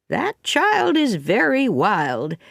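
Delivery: a touch of drawn-out outrage; indignant Mrs Lynde. Acted. Offended Mrs Lynde leaving.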